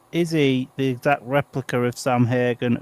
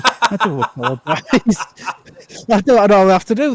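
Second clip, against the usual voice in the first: laughing; 7 semitones higher, 6 dB louder than usual. Real, not acted. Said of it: "I'm out of breath already".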